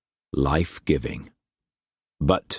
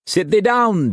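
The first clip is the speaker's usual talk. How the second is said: put-on much higher, hammy old sailor voice; old sea-dog Brock; 4 dB louder than usual. Brock welcoming Struan to his table, hammed-up old sailor. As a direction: boom it out like a rough old trader.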